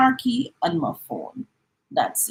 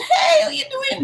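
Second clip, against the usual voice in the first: friendly greeting; 3 dB louder than usual. Retold or imitated. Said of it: "A gentleman who was ahead of me said".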